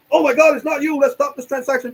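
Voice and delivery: high, loud mock-panicked agent; alarmed bank agent. Bank agent stopping the fraud, mock alarm. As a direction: put on an agent's urgent alarm.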